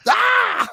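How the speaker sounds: loud high scream of getting shocked; mock electrocution scream; shocked yell. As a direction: yell as sparks hit your hands. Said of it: "He's like".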